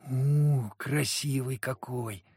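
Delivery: put-on slow, drawn-out childlike awe; admiring. A narrator's reading. Street kid admires the famous hunter, in awe. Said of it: "раздалось восхищённое".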